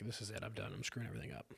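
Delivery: barely above a murmur, emptied out; hopeless inner voice. Retold or imitated. Man recalling his breakdown, quietly hopeless. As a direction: let it sink, like you've given up.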